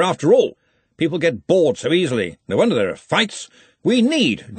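high, emphatic speechmaking; blustering senior wizard. A narrator's reading. Ridcully sets out what the new sport needs, blustering. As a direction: hold forth like a keen chairman.

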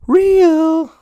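sudden squeal on real; squeaky high voice. Pretend. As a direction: jump way up high and loud. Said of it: "Sorry, that was terrible".

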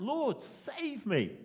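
crying out. A narrator's reading. Sinking Peter calls out to Jesus, slow pleading cry. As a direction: stretch it out as a plea to be saved. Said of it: "Cried out".

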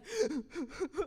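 a high, breathless laugh through tears; overwhelmed teary laugh. Real, not acted. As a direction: laugh as the emotion spills over. Overcome while telling adoption story.

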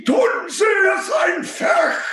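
a colonel's furious German roar; screamed German order. Acted. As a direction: scream it slowly right in his face. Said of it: "half the time I screamed it".

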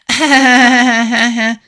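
high, loud, staccato fake laugh; mock henchman laugh. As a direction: fake a long sidekick cackle. Mimics henchman sister's laugh.

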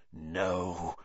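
low, soft hiss; quiet, menacing hiss. A narrator's reading. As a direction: hiss your refusal. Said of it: "he hissed".

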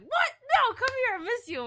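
loud, high-pitched mock exclamation; excited greeting. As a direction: exclaim it like greeting a long-missed friend. Acts out friend's excited greeting.

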